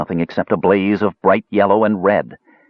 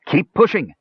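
urgent cry; 9 semitones higher than usual. A narrator's reading. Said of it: "Klotnik cried".

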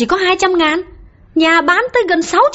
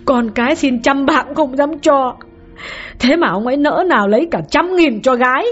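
a much higher woman's voice, lamenting in anguish; choked-up grieving wife; 13 semitones higher than usual. Acted. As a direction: pour out the grief of a betrayed wife. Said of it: "đau khổ lắc đầu nghẹn ngào".

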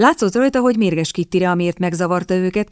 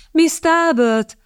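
high, drawn-out call of a name; hailing an acquaintance, high and slow; 10 semitones higher, much slower than usual. A narrator's reading. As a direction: call out to her in a high, stretched voice. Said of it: "kiáltott Lady Radcliffe".

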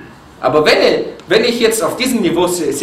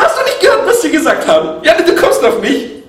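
high, raised voice of a wife protesting; Aisha's high complaint; 11 semitones higher, 3 dB louder than usual. Retold or imitated. Aisha protesting to the Prophet, high and indignant. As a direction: pitch it up as an indignant young wife.

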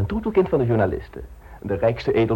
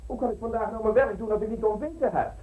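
calling out; 10 semitones higher than usual. Acted. Calling landlady back for breakfast.

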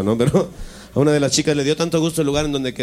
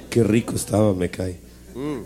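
ordinary talk

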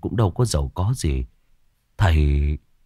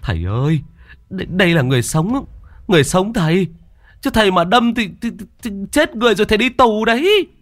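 alarmed high-pitched protest; panicked pleading; 11 semitones higher than usual. A narrator's reading. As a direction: beg him urgently to stop, tripping over words. Bystander stops the stabbing.

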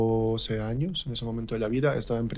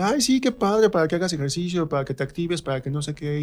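mock gushing parent, high; 6 semitones higher than usual. Teen imitating his parents' delighted approval, mock high. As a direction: imitate proud parents cheering him on, pitched up. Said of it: "me dijeron así como de".

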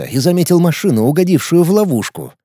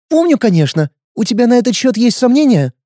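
put-on girl voice; 9 semitones higher than usual. Girl voice, higher.

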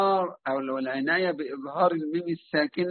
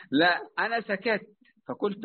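normal talking voice

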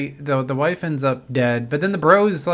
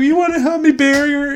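put-on emotional drunk; 10 semitones higher than usual. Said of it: "they're emotional drunks".

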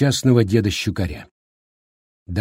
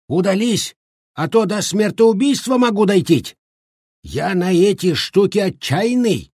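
put-on shrill high old man rage; high furious old man; 8 semitones higher than usual. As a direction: chase her out, beside yourself. Shchukar furious chasing out.

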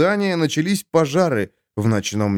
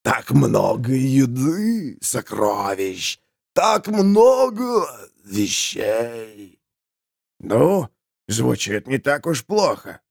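slow, moaning voice of a corpse; wailing corpse; much slower than usual. Acted. Corpse moaning to himself about riches. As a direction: drag it out like a dead man grieving.

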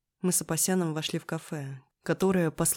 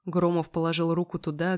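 ordinary delivery

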